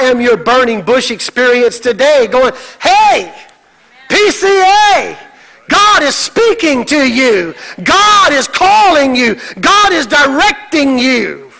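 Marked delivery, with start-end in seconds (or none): 2.8-11.5 high, drawn-out calling to the congregation; preacher yelling. Acted. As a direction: call out slowly to grab their attention. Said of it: "He's screaming at you".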